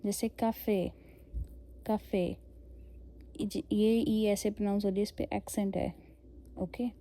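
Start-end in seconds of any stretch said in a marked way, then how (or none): 0.3-2.4 कैफ़े with a hard k sound and final é; French café as कैफ़े. Shown as a demonstration. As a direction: say कैफ़े, k at the start, e at the end. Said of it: "pronounce ऐसे करेंगे".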